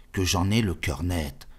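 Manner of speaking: usual speaking voice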